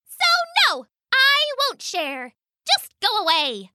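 high little mouse standing firm; defiant mouse. Acted. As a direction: refuse in a tiny, high-pitched voice. Mouse refuses pheasant.

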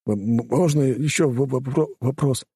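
stuttering with fear; frightened stammer. Acted. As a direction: teeth chattering stutter.

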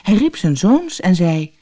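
plain reading voice

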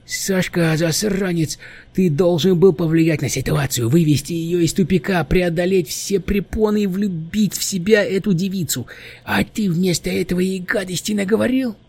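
high, reproachful little demon; scolding gremlin. A narrator's reading. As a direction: scold him like a cranky old gremlin. (укоряющий гремлин)

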